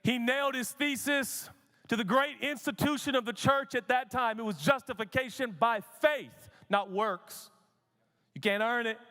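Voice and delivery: high, driving sermon delivery; full-force preaching. Real, not acted. Preacher proclaiming faith, not works, to the church, full-force preaching. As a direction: punch out the key words to the congregation.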